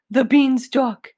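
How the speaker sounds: high, loud dread of the beanstalk; a giant cries out at the beanstalk; terrified giant. Acted.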